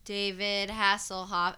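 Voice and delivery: loud, slow, drawn-out call to the waiting room; slow called-out name. Retold or imitated. Nurse calling the name a second time, slow and loud. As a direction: stretch the name as you call it.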